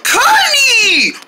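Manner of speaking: high, drawn-out call of the name; high excited holler. Acted. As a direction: cry out to a long-lost friend. Calls out to friend found underground.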